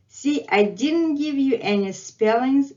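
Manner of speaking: ordinary delivery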